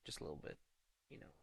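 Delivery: low, hushed teasing whisper; mock seductive whisper to a viewer. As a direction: whisper it in his ear like a tease. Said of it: "You know why I'm whispering".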